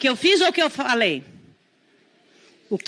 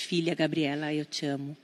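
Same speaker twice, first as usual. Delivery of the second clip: much softer, lower, sincere; tender; 6 semitones lower, 8 dB quieter than usual. Real, not acted. Mother telling her daughter Gabriela she loves her, soft and tender. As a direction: say it gently to your own daughter. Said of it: "Vou aproveitar essa oportunidade".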